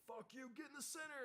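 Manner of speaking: high barking instructor; instructor yell. Retold or imitated. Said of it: "they'd be like".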